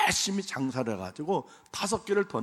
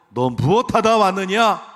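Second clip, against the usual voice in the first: God's question, declaimed; 7 semitones higher, 11 dB louder, slower than usual. Pretend. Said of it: "하나님께서 이렇게 물어보실 거예요".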